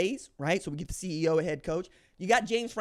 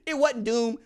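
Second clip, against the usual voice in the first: usual speaking voice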